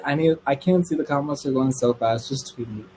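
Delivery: ordinary talk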